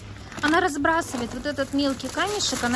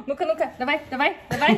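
excited; faster than usual. Real, not acted. Urging child, laughing.

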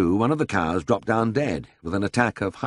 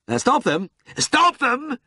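very high cry of alarm; frantic little lord screaming for help; panicked scream; 4 dB louder, slower than usual. A narrator's reading. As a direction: scream it higher the second time. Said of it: "screamed the little peer".

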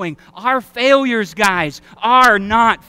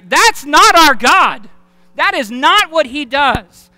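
preaching at full force; 5 semitones higher, 6 dB louder than usual. Real, not acted. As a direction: hammer it home from the pulpit. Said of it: "preaching at full force".

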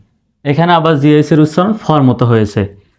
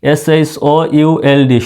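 ordinary talk

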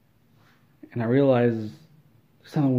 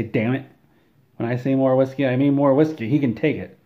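forceful acted exasperation; insistent order; 3 semitones higher, 5 dB louder than usual. Surgeon overrules a doubting nurse, insistent order. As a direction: lay down the law, voice raised a little.

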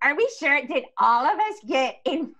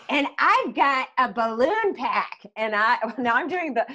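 usual speaking voice

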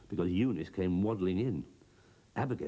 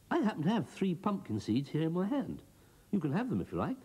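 higher, wheedling little broom voice; sly coaxing Broomstick; 11 semitones higher than usual. Acted. Broomstick tempting Abigail with pumpkin seeds, sly and coaxing. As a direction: tempt the cow sweetly, eyes glinting.

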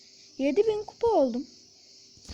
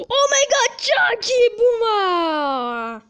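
high, loud, very slow cheer; ecstatic drawn-out cheer; 6 semitones higher, 9 dB louder, much slower than usual. Real, not acted. Gamer cheering his teammate, ecstatic stretched shout. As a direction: stretch out the praise.

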